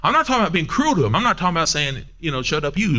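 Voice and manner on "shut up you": ordinary talk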